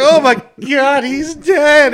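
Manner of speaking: high, loud hammed-up cry of grief over a corpse; mock emotional cry. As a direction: ham up the fake grief as loud as you can. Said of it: "It's like".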